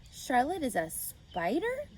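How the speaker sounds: mother hearing it's a spider, stunned; alarmed surprise. Acted. Mother questioning her daughter, slow disbelief.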